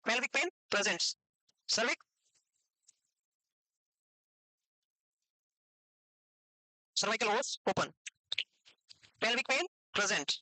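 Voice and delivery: spelled out very slowly for note-taking; slow dictation. Shown as a demonstration. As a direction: dictate each term slowly so they can write. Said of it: "लिखो". (Notes के लिए बहुत धीरे धीरे बोलना)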